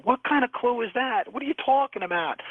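mock disbelief at a useless clue; high, baffled protest; exasperated. Retelling his exasperated reply.

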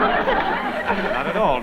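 laughing. Acted. Laughing reply to hostess.

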